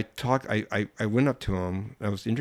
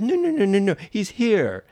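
insistent Italian woman; 12 semitones higher, 7 dB louder than usual. Retold or imitated.

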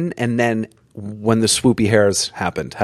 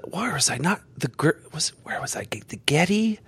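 soft, half-whispered self-questioning; searching memory in a hushed aside; muttering to himself; 2 semitones higher, 8 dB quieter than usual. Real, not acted. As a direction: think aloud under your breath, trailing into a whisper. Trying to recall where it was.

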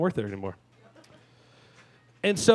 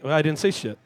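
ordinary talk, nothing marked

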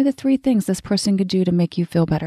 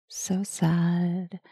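much softer teasing whisper; mock sad whisper; 8 dB quieter than usual. Host teasing listeners who dislike it, mock sad whisper. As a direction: whisper the fake sympathy.

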